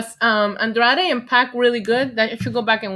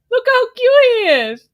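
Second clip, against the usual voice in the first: higher and louder, squealing; gushing squeal; 12 semitones higher, 7 dB louder than usual. Real, not acted. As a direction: squeal over how cute it is. Gushing over figure.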